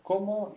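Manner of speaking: cómo held up high; high-pitched cómo. Shown as a demonstration. Teacher points out the question word, cómo high-pitched. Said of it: "high pitch because it's a question".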